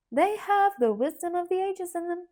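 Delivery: gentle but firm teacher tone; teacherly. Acted. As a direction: explain it like a kindly schoolteacher. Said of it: "in her teacherly manner".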